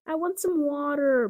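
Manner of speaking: put-on high, loud pleading child; begging. A narrator's reading. Benny begging his brother for water, high pleading. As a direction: plead for it like a thirsty little boy. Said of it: "begged Benny".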